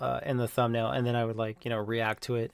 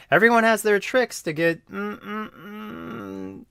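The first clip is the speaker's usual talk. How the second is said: high, fading suggestive hums; mock suggestive voice; 9 semitones higher, slower than usual. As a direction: trail off into coy hums. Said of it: "PewDiePie tweeting".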